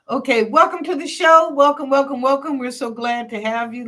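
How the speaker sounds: high, loud, bright-eyed host greeting; big cheery show-opening welcome; excited welcome. Real, not acted. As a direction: throw the welcome out with energy.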